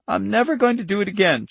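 put-on high, emphatic little-girl voice; vehement child's vow. Charlie vows to stop making fire, vehement. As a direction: swear it with feeling.

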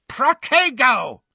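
high, drawn-out incantation; shouted spell. A narrator's reading. Harry casts a spell in duel.

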